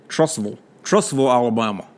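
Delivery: low, soft Trussville with "ville" as a dark "vul"; local pronunciation. Shown as a demonstration. Host repeats the local name for the caller, Trussville as "Truss-vul". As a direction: swallow the end of Trussville, like "vole". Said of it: "Like the ville is a vole".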